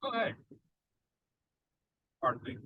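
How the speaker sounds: talking through a mouthful; mouth full. Real, not acted. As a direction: speak around a bite of food. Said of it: "you got your mouth full".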